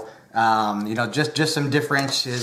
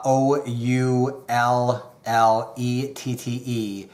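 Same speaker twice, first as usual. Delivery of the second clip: usual speaking voice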